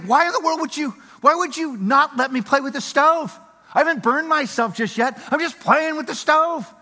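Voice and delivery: put-on high, indignant child voice; whiny child protest. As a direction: protest like a kid denied a toy.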